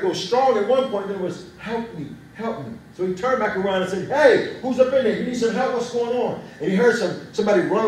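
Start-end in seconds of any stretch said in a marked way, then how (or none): 1.6-2.7 softer, fading cry for help; fainter cry. Retold or imitated. Woman's voice in the bushes fading, softer faint cry. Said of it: "the voice began to get fainter and fainter". 4.1-6.4 louder, higher call into the bushes. Retold or imitated. Man calls into the bushes to whoever is there, louder higher call.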